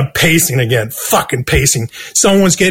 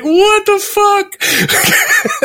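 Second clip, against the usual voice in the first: much higher, drawn-out what the fuck; stunned accountant; much slower than usual. Retold or imitated. Accountant woken at one in the morning, slow high disbelief. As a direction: stretch it out in disbelief.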